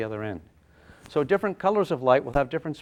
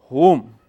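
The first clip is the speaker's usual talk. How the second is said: whoosh sound; 2 semitones higher, 9 dB louder than usual. Acted. Photon zooming in, sound effect.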